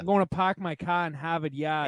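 hammed-up Boston R-dropping; Massachusetts accent. Classic accent test line for friends, mock Massachusetts accent. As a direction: drop every R like a Boston local. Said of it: "you gotta say it with an accent".

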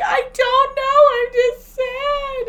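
high, slow, loud mock sob; retelling her own tearful phone call, mock crying; imitating crying. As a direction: wail it out, hammed-up. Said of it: "why are you crying now".

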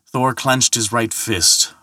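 plain reading voice

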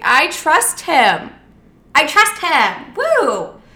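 hammed-up starry-eyed cheer; mock naive confidence. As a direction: lampoon her trusting younger self. Mocking her naive past self.

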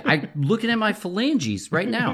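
high, loud mock conviction; emphatic insistence. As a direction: say it like you mean it. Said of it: "You have to believe it".

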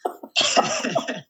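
breathless laugh far up; high wheezing laugh. Real, not acted. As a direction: let out a high airy laugh. Laughing at embarrassing story.

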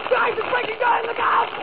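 very high, mounting mock panic; hammed-up shriek of alarm, pitch climbing; screamed warning. Warning Stephanie the lake ice is breaking, high screamed alarm. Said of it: "I screamed".

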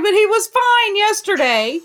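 a hammed-up, indignant wife quoting her husband's sudden illness; exasperated wife. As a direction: ham up the fed-up wife's complaint. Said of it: "she's like".

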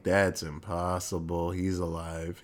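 mock ominous realization; hushed disbelief. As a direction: let the dread sink in quietly. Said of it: "And Otto's like".

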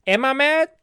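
mock self-question. Real, not acted. Ranting about cancelled Disney trip.

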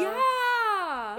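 high, loud delighted yeah; excited squeal. Real, not acted. Excited reaction to thank-you videos.

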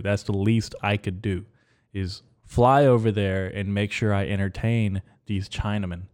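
ordinary talk

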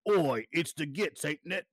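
a rough Cockney orc taunt, lightly done; mock Cockney orc. Host mimics orcs for Josh in mock Cockney. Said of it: "they talk with, like, almost Cockney accents".

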